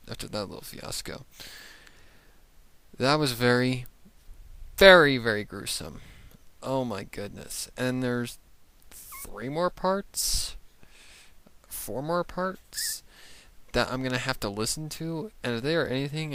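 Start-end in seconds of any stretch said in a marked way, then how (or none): 3.0-6.0 loud, leaning hard on every "very"; emphatic disgust. Real, not acted. Reacting to gruesome story. 8.9-13.0 slow, higher, drawn-out disbelief; dismayed disbelief. Real, not acted. Dismay at more episodes.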